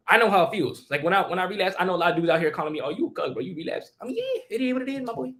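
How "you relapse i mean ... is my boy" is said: usual speaking voice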